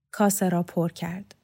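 plain reading voice

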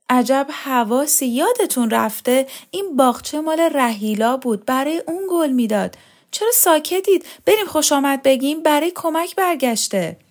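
high, mounting insistence; excited urging. A narrator's reading. Woman urging silent relatives to give welcome, excited. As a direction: press them eagerly, pitch climbing.

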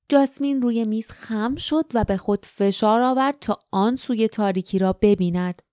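plain reading voice

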